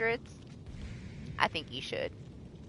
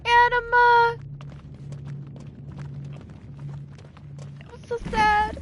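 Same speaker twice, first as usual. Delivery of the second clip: mock-tragic wailing; 14 semitones higher than usual. Real, not acted. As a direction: cry his name in grief. Mourning teammate.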